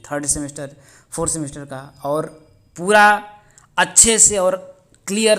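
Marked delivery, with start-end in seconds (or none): 2.0-3.3 drawn out, louder और पूरा; emphatic and slow. Real, not acted. Teacher promising full syllabus, emphatic stretch on और पूरा. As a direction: lean hard on और पूरा. Stressing full coverage of papers.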